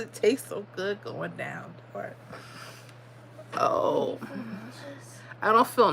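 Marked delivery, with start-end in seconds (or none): none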